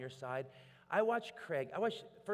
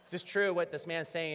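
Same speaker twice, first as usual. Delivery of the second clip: louder, higher urging; urgent quote; 6 semitones higher, 10 dB louder than usual. Retold or imitated. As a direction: press her to answer the cop. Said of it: "And I was like".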